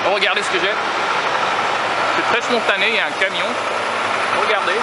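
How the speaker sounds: high, loud excited call to the camera; suddenly thrilled, look at this. Real, not acted. Spots a passing train, excited. (enthousiaste, voix haute et forte)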